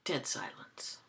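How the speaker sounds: normal talking voice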